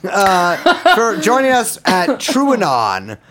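slow, laughing sign-off; hammy host farewell; mock podcast outro. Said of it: "podcast sign-off".

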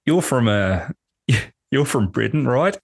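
put-on Australian accent. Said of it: "I'll give the accent again".

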